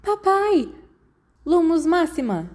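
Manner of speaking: high frightened cry for Dad; desperate high call, nearly a scream; crying out from nightmare. Acted. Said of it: "quase gritando".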